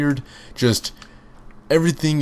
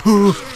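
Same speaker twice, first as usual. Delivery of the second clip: sudden high startled gasp; sharp gasp; 11 semitones higher, 6 dB louder than usual. Real, not acted. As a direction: gasp as something pounces. Feral jumps him.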